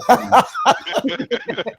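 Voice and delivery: a sudden squeal of laughter, way up high; high shrieking laugh. Real, not acted. Friends mocking a crush, high shrieking laugh.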